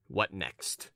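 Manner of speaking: low, soft and cool; understated curt impatience. Acted. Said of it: "what a tone you take".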